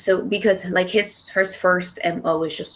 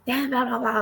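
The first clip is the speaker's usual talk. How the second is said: high, rapid imitated rant; fast high-pitched mock yell; mock screaming; 7 semitones higher, 3 dB quieter, faster than usual. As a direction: mimic a screamed tirade without real volume. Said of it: "just starts screaming".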